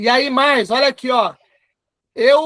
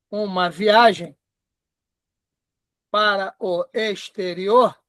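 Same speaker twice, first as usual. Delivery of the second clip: slow, word by word, lower; slow dictation; 4 semitones lower, slower than usual. Shown as a demonstration. Typing the client's reply for the class, slow dictation. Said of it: "eu tô digitando aqui porque eu tô simulando".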